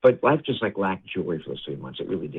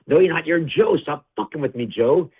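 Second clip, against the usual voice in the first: high, loud irritated dad; angry denial; 7 semitones higher, 4 dB louder than usual. Retold or imitated. Confused father scolding his son as Joe, loud and high. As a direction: fling it back high and loud, fed up. Father angrily denies son's identity.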